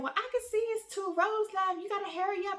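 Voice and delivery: a hint of an urgent friend; owner urging her. Voicing the owner on the phone.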